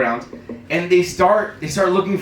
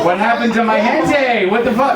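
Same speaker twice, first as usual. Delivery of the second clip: raised, higher-pitched protest; mock outcry; 6 semitones higher, 4 dB louder than usual. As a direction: cry out in mock dismay.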